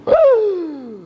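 mock scandalised high gasp; shocked gasp. Mimics disciples' astonishment.

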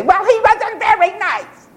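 mock prissy critic. Preacher mocking the pacifists who call Jesus unkind, in a high sneer. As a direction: mimic a priggish critic. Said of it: "And they say".

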